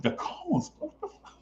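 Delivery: disbelief. Retold or imitated. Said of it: "And I'm like".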